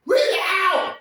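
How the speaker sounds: high, loud, manic yelling; demanding yell. Retold or imitated. Terry yells at Ez to read the letter, high and manic. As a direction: bark it at him, manic and loud.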